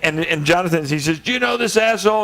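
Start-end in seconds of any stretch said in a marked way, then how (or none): 1.2-2.2 retold scream. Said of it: "And he's screaming".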